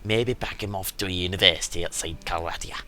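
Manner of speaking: lightly voiced Scottish-ish merchant; a hint of a Scottish merchant; put-on merchant accent. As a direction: read the merchant in a made-up accent. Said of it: "I can't remember his accent".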